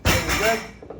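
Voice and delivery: very good called out loud; loud praise. Real, not acted. Coach praising the class after a set, called out loud. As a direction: cheer the class on. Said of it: "coach praising class".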